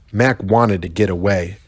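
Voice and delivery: plain reading voice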